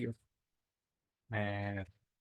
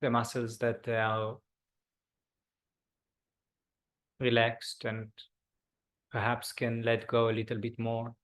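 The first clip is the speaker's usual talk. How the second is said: guided meditation. Acted. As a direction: ease the group into stillness. Leads a body scan meditation.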